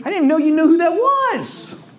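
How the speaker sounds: high, laughing mock surprise. Retelling own joke, laughing.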